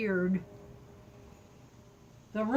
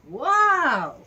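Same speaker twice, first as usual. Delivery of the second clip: higher, louder gasp of wonder; amazed wow; 3 semitones higher, 6 dB louder than usual. Pretend. Peter, amazed at his new strength, an awed wow. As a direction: let it burst out in awe.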